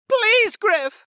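very high pleading cry; high-pitched tearful plea; desperate, near sobs. A narrator's reading. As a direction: call out to him in anguish. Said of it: "cut through the sound of sobs".

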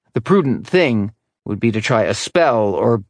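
ordinary delivery, nothing marked

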